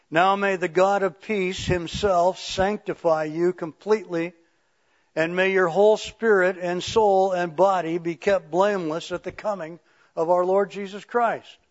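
projected, emphatic benediction from the Bible; scripture reading. Acted. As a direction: proclaim the verse to the congregation. Reads scripture aloud.

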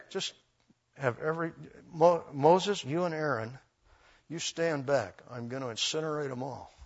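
very soft, understated warning; hushed, halting acted command; quiet warning. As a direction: deliver the threat under your breath. Lord's warning to Moses, acted.

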